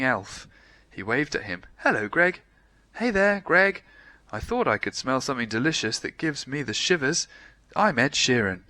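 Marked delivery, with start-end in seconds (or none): 1.8-8.6 high, loud chirpy elf; friendly elf voice. A narrator's reading. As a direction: greet him as a cheerful elf.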